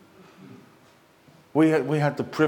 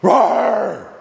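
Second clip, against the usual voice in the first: much louder and higher, a big roar; roared word; 8 semitones higher, 8 dB louder than usual. Pretend. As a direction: let the word roar like a lion. Acting a lion's roar.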